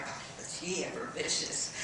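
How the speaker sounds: breathy whisper of scandal; a juicy secret, whispered; whispered gossip. Acted. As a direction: lean in and whisper it. Gossipy confidential aside.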